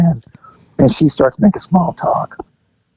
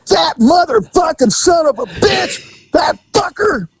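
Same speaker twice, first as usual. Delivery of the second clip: high, drawn-out enraged yell; imitated angry hollering; 12 semitones higher, slower than usual. Arrested woman heard through the wall, imitated furious hollering. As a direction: holler the curses high, mimicking her rage. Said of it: "a whole bunch of hooping and hollering".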